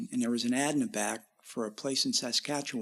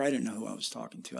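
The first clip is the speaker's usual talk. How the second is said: ordinary talk, nothing marked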